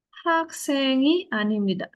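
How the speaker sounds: "haksengi anim nida" said slowly, ending on a fall; slow Korean model sentence. Shown as a demonstration. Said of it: "Dian bukan seorang murid". (kalimat Korea dibaca pelan)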